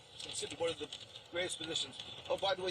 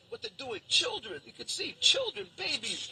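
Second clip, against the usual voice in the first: anguished outcry; 10 semitones higher, 5 dB louder than usual. Real, not acted. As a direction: cry out in grief at the footage. Showing soldiers arresting children.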